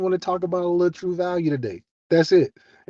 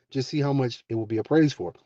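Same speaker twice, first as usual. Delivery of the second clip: usual speaking voice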